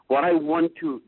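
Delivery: normal talking voice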